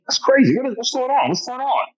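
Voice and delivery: high, startled what's-going-on; amazed exclaim. Retold or imitated. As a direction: bodybuilder startled by the roaring crowd, high voice. John surprised by screaming crowd.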